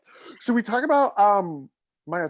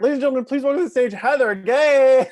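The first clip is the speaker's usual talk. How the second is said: hype announcer; 7 semitones higher, 6 dB louder than usual. Pretend. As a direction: rush the intro then stretch the name. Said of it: "announcer intro of co-host".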